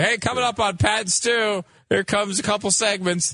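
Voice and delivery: high-pitched mock promo read; show intro. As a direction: imitate your own scripted show tease. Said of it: "We say".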